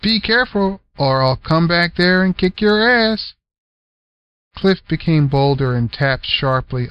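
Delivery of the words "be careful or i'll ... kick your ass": mock threat. Unc threatening.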